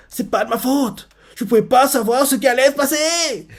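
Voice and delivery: voix aiguë et geignarde qui se défend; Deku paniqué imité. Retold or imitated. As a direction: singer un gamin qui se dédouane, très aigu. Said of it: "il dit les mots qu'il pense que Deku dirait". (l'héritier singe Deku, voix aiguë et geignarde)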